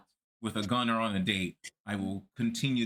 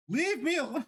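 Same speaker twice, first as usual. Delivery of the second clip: much higher, pleading; whiny high plea. Real, not acted. Whining about country songs everywhere.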